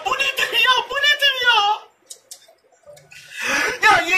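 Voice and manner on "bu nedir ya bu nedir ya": disbelief. Real, not acted. Repeats in disbelief, pitch up.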